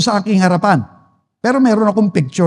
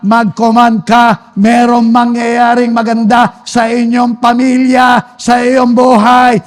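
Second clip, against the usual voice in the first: slow, building, drawn-out exhortation; preaching at full force; 4 dB louder than usual. Real, not acted. Preacher urges believers to command over their families, full-force preaching. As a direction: stretch every phrase into a mounting declaration.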